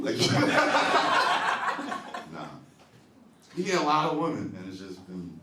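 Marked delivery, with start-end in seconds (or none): 0.2-2.3 high wheezing laugh; breathless cackle; breathy laugh. Real, not acted. Laughing at own joke.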